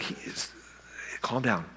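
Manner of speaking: whispered, calming; hushed aside. Acted. Martha quietly hushing Jesus, whispered calming aside. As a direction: hush him so nobody hears.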